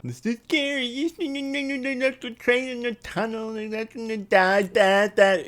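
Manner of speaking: hammed-up panicky engine; mock scared. Imitating Henry scared in the tunnel, mock frightened. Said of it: "you know".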